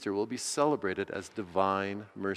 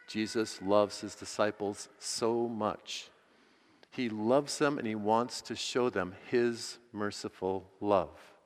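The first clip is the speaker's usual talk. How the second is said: normal talking voice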